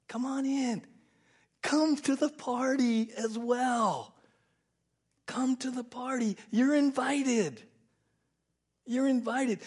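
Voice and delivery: high, loud, slow pleading call; father calling son to party. Retold or imitated. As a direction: beg him warmly to come in.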